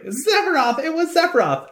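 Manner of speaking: high falsetto blurt; mock terrified squeal; Palmer voice. Palmer tells who did it, high comic squeal. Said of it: "And he's like".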